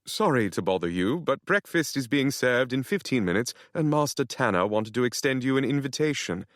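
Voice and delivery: hint of a cheeky British houseboy; sly British butler. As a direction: tease it politely in a British lilt. Nigel the houseboy speaking.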